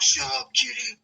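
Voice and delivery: high-pitched mock telling-off; mock scolding. Drunk man telling off his barking dog, mock scolding.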